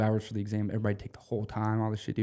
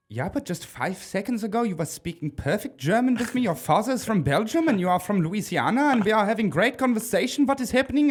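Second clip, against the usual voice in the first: higher puzzled barmaid voice; a confused German barmaid, pitched up; beer maid; 9 semitones higher than usual. Retold or imitated. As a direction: barmaid baffled by the sudden loss of German. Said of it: "she was like".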